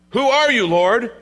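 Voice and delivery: loud, high-pitched appeal to the Lord; startled questioning. Acted. Saul, God's servant, questions the voice from heaven, startled. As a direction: cry it upward, lifting on the last word. Acting out Saul's repeated question.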